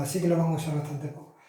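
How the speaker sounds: ordinary delivery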